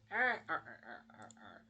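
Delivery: staccato gloating chuckle; Mister Krabs. Retold or imitated. Krabs flicks on the lights, mock gloating laugh. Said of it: "flicks on the lights and he goes".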